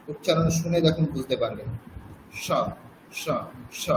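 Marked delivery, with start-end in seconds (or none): 2.3-3.9 স said as sh with tongue on the palate; soft palatal স, like sh. Shown as a demonstration. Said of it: "আমি যদি তালব্য স উচ্চারণ করি".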